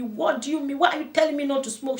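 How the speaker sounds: imitating the furious smoker; mock angry outburst. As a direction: snap back in retold anger. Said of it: "She was almost grabbing my neck".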